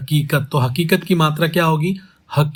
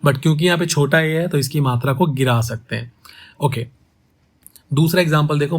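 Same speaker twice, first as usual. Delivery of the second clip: ordinary talk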